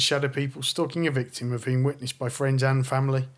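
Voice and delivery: ordinary talk, nothing marked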